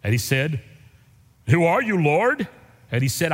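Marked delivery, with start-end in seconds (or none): 1.5-2.5 higher, louder startled question; Saul's startled question. Acted. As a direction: cry out in sudden awe. Saul answers in scripture reading.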